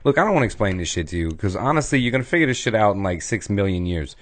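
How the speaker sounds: fast, impatient brush-off; hurried mock God, fed up. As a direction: rattle it off like you can't be bothered. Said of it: "being real impatient and being like".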